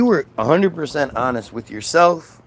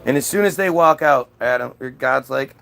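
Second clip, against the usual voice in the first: ordinary delivery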